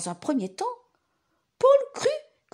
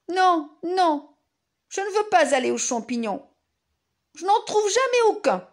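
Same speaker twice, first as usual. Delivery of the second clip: put-on child voice; 7 semitones higher, 4 dB louder than usual. Paul refuses, child voice.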